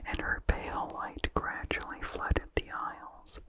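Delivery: usual speaking voice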